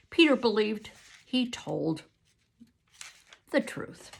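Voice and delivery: plain reading voice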